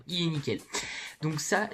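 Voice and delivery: ordinary talk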